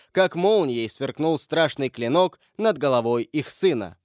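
usual speaking voice

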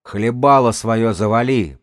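loud, slow, angry growl; growled order. A narrator's reading. Gang's elder silences his lookout, slow loud growl. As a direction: growl at him to shut up. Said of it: "прорычал старший".

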